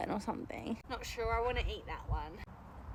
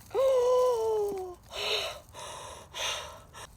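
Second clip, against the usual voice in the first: gasp; 10 semitones higher, 5 dB louder than usual. Real, not acted. Big find while digging.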